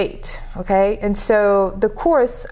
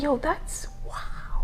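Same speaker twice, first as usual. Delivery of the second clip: slow, hushed amazement; awed whisper; 4 semitones higher, 10 dB quieter, much slower than usual. Retold or imitated. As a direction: breathe it out in wonder. Retold awe at language shift.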